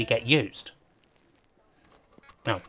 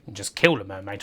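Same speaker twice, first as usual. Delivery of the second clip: normal talking voice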